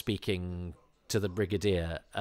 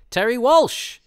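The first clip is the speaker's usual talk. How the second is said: excited recognition; 10 dB louder, slower than usual. Real, not acted. Spotting the stuntman on screen.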